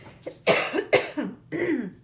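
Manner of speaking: persistent tickly cough; dry cough. Real, not acted. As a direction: cough that won't go away. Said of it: "this tickle will not go away".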